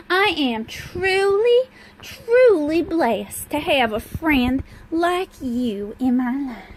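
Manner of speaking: slow, gushing Southern drawl; fancy Southern accent. Pretend. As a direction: draw it out in a hammed-up Southern accent. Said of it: "I'm speaking in a Southern accent".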